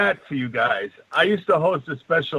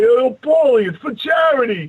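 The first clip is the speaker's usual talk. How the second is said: higher, louder call to a celebrity; retold shout; 6 semitones higher, 4 dB louder than usual. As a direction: shout after him across the crowd. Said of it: "I'm like".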